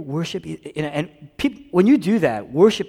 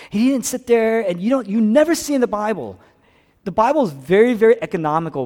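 loud, high-pitched preacher's urgency; impassioned preaching; 10 semitones higher, 10 dB louder than usual. Real, not acted. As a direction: preach it at full force, voice raised. Preaches at full force.

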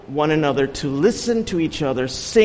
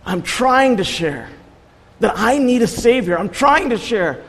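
near-tearful plea; 8 semitones higher than usual. Retold or imitated. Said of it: "almost tears in his eyes".

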